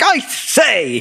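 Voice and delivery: high, exaggerated upper-class British "I say!"; British playboy exclamation. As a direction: ham up the rich British playboy. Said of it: "always exclaiming things like".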